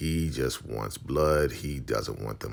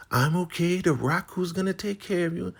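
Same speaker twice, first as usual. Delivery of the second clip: high, loud put-on voice; proud Marva; 5 dB louder than usual. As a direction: refuse help proudly. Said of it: "She's like".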